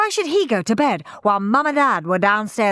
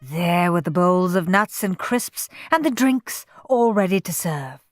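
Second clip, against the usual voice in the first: ordinary delivery, nothing marked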